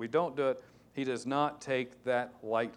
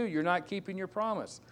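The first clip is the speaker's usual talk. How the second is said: usual speaking voice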